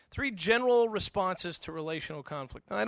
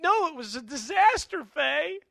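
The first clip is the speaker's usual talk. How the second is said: high, loud exasperated protest; upset outburst; 9 semitones higher, 7 dB louder, slower than usual. Acted. Upset doctor snapping at his reassuring wife, exasperated high shout. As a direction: play a man frantic with frustration. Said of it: "he's all upset".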